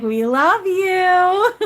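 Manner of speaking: high, loud, very drawn-out cooing; gushing affection. Retold or imitated. Spirits hugging Carolyn, gushing affection. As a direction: stretch it out like a huge squeezing hug.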